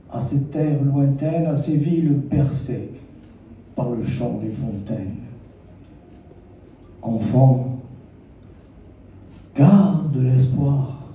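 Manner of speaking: measured verse with long pauses between phrases; poetry recital. Acted. Poet reciting verses for a child of the Sahel, poetry recital. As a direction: recite lyrically, lingering on the line endings.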